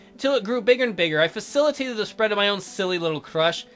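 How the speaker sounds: ordinary talk, nothing marked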